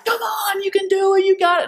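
high-pitched rallying shout; yelling. Retold or imitated. Boss in the road to a finishing racer, yelling. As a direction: shout them home, pitch way up. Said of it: "Yell, yell".

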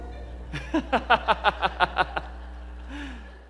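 long amused laugh; real laugh after the act; laughing at himself. Real, not acted. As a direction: burst out laughing. Laughs after his own performance.

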